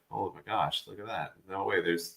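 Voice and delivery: quietly amazed, playing the moment; hushed amazement. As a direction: drop to a hush on spotting something. Acting out spotting the shad.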